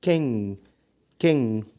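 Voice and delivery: "king" ending on the nasal, no hard g; silent final g. Shown as a demonstration. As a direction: finish "king" without releasing a g. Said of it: "قول".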